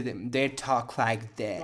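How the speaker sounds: slow exaggerated American accent. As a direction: mimic an annoying American guest's accent. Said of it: "ces invités ont un accent américain insupportable".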